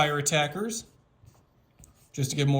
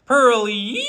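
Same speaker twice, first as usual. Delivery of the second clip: high, loud cartoon shout; cartoonish voice; 6 semitones higher, 9 dB louder than usual. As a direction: belt it like a zany cartoon announcer. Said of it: "I have to say this in a cartoonish voice".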